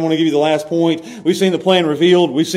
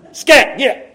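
shooing off; 5 semitones higher than usual. Retold or imitated. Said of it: "just go".

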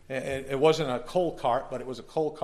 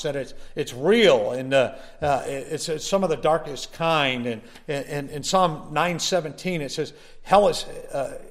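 preaching at full force; 4 semitones higher, 6 dB louder than usual. Acted. Preacher warns the congregation about hell, at full force. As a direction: thunder it out from the pulpit.

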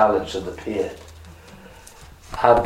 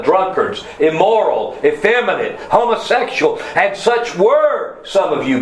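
building sermon list; 8 semitones higher than usual. Real, not acted. Preacher listing Corinthians' sinners, building to a high climax. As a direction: pitch climbing item by item to a high peak.

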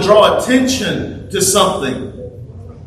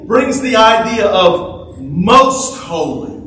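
ordinary talk

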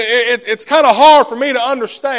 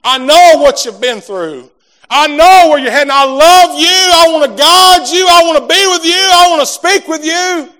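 higher, louder, earnest pleading; God's loving voice; 6 semitones higher, 7 dB louder than usual. Acted. God calling to the congregation, rising earnest voice. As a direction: speak as God calling tenderly but full. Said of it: "God may be whispering in your heart".

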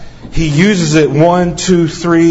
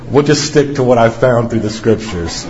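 laughing; 3 semitones lower than usual. Real, not acted. Preacher laughing off the watch and returning to scripture. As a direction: speak the line through a laugh. Watch assistant interrupts sermon.

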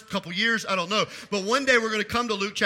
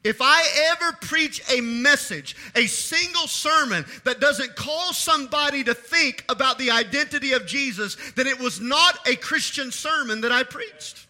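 higher, driving sermon delivery; impassioned preaching; 6 semitones higher, 4 dB louder than usual. Real, not acted. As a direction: preach it out with rising conviction.

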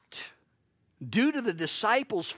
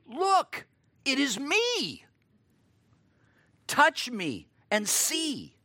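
"Look" jumps much higher, then a slow "Touch me and see."; insist, with "Look" thrown up high; urgent emphatic "Look, it is me"; 8 semitones higher, slower than usual. Shown as a demonstration. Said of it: "If we were to say it ourselves".

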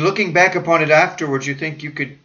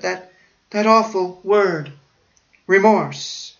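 high, slow horrified voice; horrified dying man; 6 semitones higher, slower than usual. Retold or imitated. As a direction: dread the awful word. Dying man's horror.

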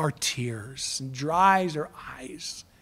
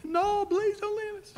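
high, softer, whimpering mock plea; mock crying plea; 13 semitones higher, 5 dB quieter than usual. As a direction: plead like tearful disciples, hammed-up. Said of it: "They started crying".

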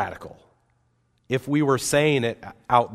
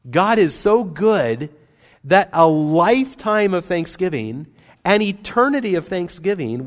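emphatic preaching; 7 semitones higher, 6 dB louder than usual. Real, not acted. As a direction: lift it up and proclaim it. Preacher stressing God's goodness.

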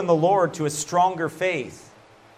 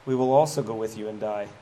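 lower, solemn earnest disciple; Thomas, lower voice; 6 semitones lower than usual. Retold or imitated. Thomas answering Jesus, low solemn vow. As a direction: voice Thomas with grave resolve. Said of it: "Thomas looks at him and goes".

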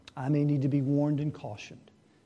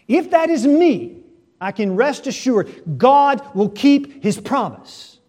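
higher and louder, driving sermon delivery; preaching at full force; 6 semitones higher, 8 dB louder than usual. Acted. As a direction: preach it out to the whole congregation. Preacher warns of final judgment.

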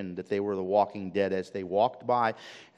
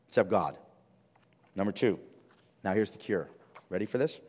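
ordinary talk, nothing marked

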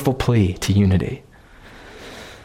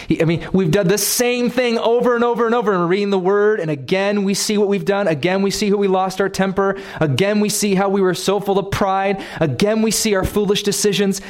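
higher, faster impassioned preaching; fervent preaching; 6 semitones higher, faster than usual. Acted. Preacher to congregation on repeated sin, rising fervent delivery. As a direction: press the point home with rising fervor.

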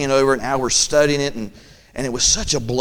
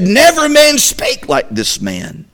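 high, slow, drawn-out proclamation; officers' awed report; 12 semitones higher, 7 dB louder, slower than usual. Acted. Officers to Pharisees, slow high awestruck proclamation. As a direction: stretch each word in amazement. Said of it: "the officers answered and said".

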